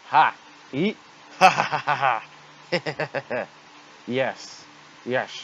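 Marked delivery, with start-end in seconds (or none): 1.4-3.5 slow booming evil laugh; evil laughter. Acted. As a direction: cackle like a gloating villain. Villain laughs in cutscene.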